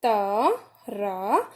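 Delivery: ता, रा drawn out very slowly syllable by syllable; stretched teacher syllables; slow spelled syllables. Shown as a demonstration. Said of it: "त्रा किससे बनता है?".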